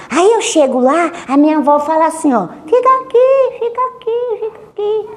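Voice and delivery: put-on girl quoting grandma's hushed order; child voice. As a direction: tell it like a kid, then hush. Girl imitating grandma's whisper.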